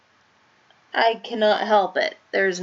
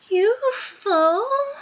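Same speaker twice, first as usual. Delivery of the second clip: "U F O?" much higher, drawn out slowly in disbelief; stunned echo; much slower than usual. Acted. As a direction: repeat "U F O?" high and slow, astonished. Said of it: "It's a U F O".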